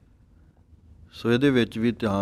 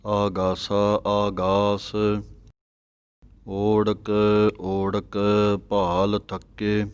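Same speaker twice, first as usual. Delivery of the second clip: slow, measured sacred recitation with drawn-out vowels; slow devotional Gurbani reading; scripture recitation; much slower than usual. Shown as a demonstration. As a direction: recite each phrase deliberately, holding the long vowels.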